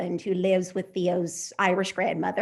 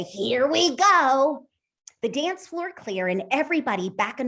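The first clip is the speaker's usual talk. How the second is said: high, breathless storytelling; excited story reading; 4 semitones higher than usual. A narrator's reading. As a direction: tell it like a thrilled child watching. Author reads her novel aloud.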